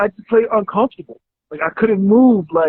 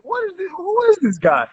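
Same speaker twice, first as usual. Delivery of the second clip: incredulous; 11 semitones higher than usual. Retold or imitated. First hearing Sebi's claims.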